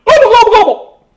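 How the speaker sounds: high, loud, fast gobbling like a turkey; turkey gobble. A narrator's reading. Bartholomew tries to bark but gobbles like a turkey. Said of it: "and went".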